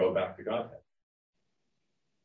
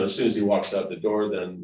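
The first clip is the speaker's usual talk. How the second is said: normal talking voice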